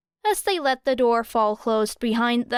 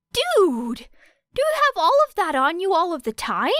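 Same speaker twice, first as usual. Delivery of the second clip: higher, louder, quick amazed blurt; 8 semitones higher, 4 dB louder than usual. A narrator's reading. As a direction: blurt it out, wowed by the gear. Kaminari amazed at her tools.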